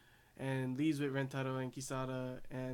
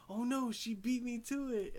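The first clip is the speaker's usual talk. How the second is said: put-on high, childish girl's whine; jealous little girl; 10 semitones higher than usual. Enju jealous that Tina got to Rentaro first, high childish pout. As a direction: pout it out like a kid who lost her turn. Voicing Enju's jealous reaction.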